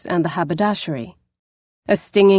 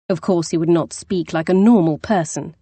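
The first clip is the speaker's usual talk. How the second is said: usual speaking voice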